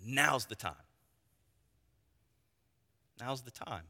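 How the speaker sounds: low, soft, solemn; quiet divine decree. Acted. God telling Othniel the hour has come, low and soft. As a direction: speak it hushed as God's decree.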